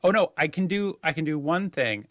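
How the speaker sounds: usual speaking voice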